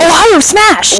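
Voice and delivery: high, shouted anime hero battle cry; anime attack shout. Pretend. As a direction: yell it like an anime hero's special move. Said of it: "having a character say a bunch of U S states' names before throwing a punch".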